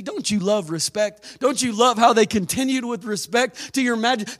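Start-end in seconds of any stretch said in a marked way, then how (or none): none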